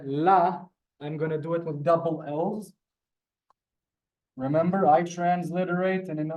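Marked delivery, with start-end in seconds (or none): none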